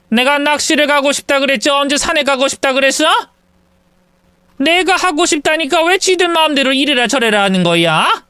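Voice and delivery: mock indignant mother in a family quarrel; angry mother-in-law. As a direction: rage that nobody listens to her. Mother angrily rejects proposals.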